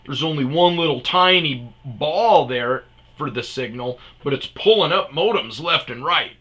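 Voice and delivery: heated, emphatic retelling; retold angry rant. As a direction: pile up the proof, hammering each point.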